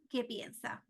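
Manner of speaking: ordinary talk, nothing marked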